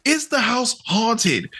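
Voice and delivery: slow, high, demanding quote; Domingo demanding. Retold or imitated. Domingo bluntly confronting the old couple, slow and high. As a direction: slowly press the landlords for the truth.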